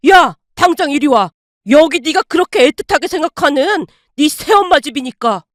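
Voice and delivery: loud outraged shouting down the phone; furious yelling. Acted. Angry father shouting at his son over the phone. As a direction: roar at the son to come over now.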